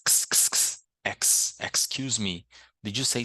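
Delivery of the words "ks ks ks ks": "x" as a voiceless ks, repeated; isolated x sound. Shown as a demonstration. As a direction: hiss out "x" as bare "ks" bursts. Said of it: "Aqui tem um som de x".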